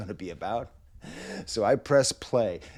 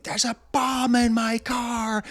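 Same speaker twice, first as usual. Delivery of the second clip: mock scream; 12 semitones higher, 3 dB louder, slower than usual. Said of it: "the guy starts screaming".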